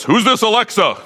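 jealous Siri. Retold or imitated. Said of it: "And Siri goes".

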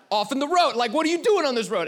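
high, loud exasperated ranting; super angry. Acted. Driver ranting at a truck in the road, super angry. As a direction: demand an answer from whoever blocks the road. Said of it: "you're just super angry".

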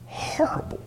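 soft breathy whisper of horrible; whispered aside. Acted. Preacher whispering to the men about careers.

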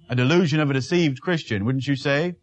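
ordinary delivery

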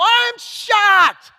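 high, slow, loud astonished Paul; shocked Paul. Retold or imitated. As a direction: act out Paul's shock, drawn out and big. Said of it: "That's what he's doing here".

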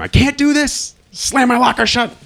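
high, loud panicked shout; screaming in sudden panic. Retold or imitated. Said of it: "I scream".

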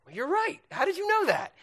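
high, loud, incredulous reply; mock amazement. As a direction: exclaim in exaggerated astonishment.

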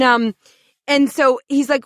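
normal talking voice

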